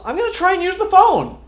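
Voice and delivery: high, loud burst of resolve; excited. Real, not acted. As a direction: announce it with sudden excitement. Excited decision to use phone.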